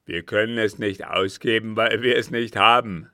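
very loud, exasperated captain; angry outburst. A narrator's reading. Captain telling the count of the loss, angry. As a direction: hammer it home at full volume.

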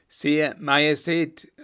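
laut, hoch und langsam gerufen. A narrator's reading. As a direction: dem Kaiser aus dem Fenster laut zurufen. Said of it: "rief er mit lauter Stimme". (lauter, hoher, gedehnter Ruf)

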